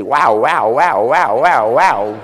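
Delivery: Texan drawl. Retold or imitated. American tank soldier from Texas greets little boy, mock Texan accent. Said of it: "he had a very strange accent".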